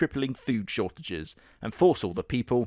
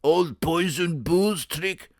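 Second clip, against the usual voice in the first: higher gruff cartoon bear voice; Mister Bear voice; 6 semitones higher, 3 dB louder, slower than usual. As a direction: scoff at the trick in a silly bear voice. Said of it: "Mister Bear is like".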